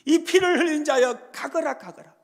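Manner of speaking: loud jeering cry, fading at the end; mocking shout. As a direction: hurl it at the king. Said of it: "그러는 겁니다". (비웃는 외침)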